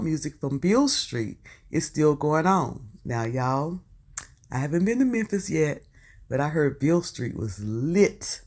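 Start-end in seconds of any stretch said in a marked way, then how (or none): none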